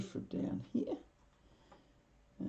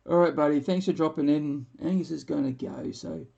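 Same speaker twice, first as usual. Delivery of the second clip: loud cheerful sign-off; 8 dB louder than usual. Real, not acted. Signing off to viewer.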